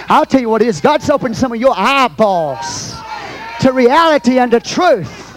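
high, shouted climax with stretched words; full-force preaching. Real, not acted. Preacher telling the house God's opened eyes, full-force shout. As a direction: proclaim it to the whole house, building to a shout.

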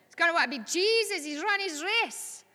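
really excited. Retold or imitated. Preacher recalling her joy at a evangelist's promotion to heaven, excited. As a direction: lift the pitch in thrilled realisation. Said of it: "but I was really excited".

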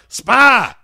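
loud, high mock yell; explosive one-word accusation; sudden accusing shout. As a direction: fire it off as a paranoid bellow. Repeats character's shouted accusation.

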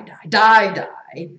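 stack up the dies, first one punched; die, die, die trailing down; lowercase die. Acted. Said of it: "Like all lowercase".

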